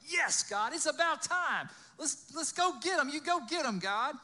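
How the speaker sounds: loud, high, eager egging-on; excited cheering. Acted. Moses egging God on to go get them, loud and excited.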